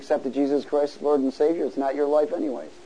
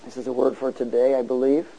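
ordinary talk

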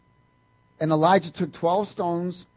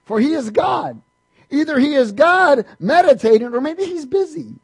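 mocking; 8 semitones higher, 6 dB louder than usual. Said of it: "Elijah mocked them".

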